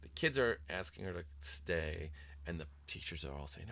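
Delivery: ordinary delivery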